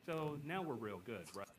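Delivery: barely audible, almost silent; far too quiet. Shown as a demonstration. Demo of a recording set too quiet, nearly silent. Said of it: "this is really quiet".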